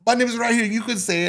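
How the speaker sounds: high-voiced mimicked insistence; imitating resort man. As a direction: coax the listener in his high voice.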